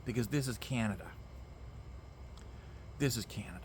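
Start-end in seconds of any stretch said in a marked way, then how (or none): 3.0-3.6 whisper. Shown as a demonstration. Dramatic repeat, then whispered.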